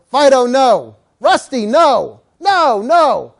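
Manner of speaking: loud, emphatic noes; scolding. Acted. Owner to misbehaving dog, loud scolding. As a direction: scold the dog by name, firmly.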